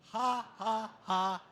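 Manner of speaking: loud open ha ha ha; leading the standing crowd in laughter yoga, loud hearty ha. Shown as a demonstration. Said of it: "we're gonna start down here".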